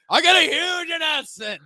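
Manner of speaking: high, loud mock announcer; announcing big news. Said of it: "Dixie Jacksonville guy".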